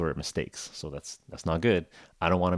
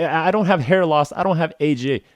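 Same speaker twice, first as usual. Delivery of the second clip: high, loud, urgent rattling off; hammed-up patient reciting everything he doesn't have; eager rattled list; 9 semitones higher, 6 dB louder than usual. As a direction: rush through the list to get it over with. Reciting list over aizuchi.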